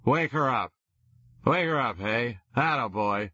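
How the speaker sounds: put-on drunk pleading. A narrator's reading. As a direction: wheedle like a sloppy drunk. Drunk Holden pleading loudly.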